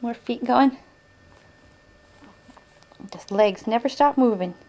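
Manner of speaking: high singsong coaxing voice. Real, not acted. Mother coaxing baby.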